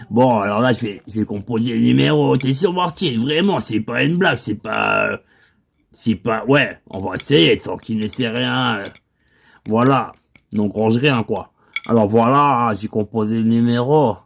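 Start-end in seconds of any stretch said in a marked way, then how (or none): none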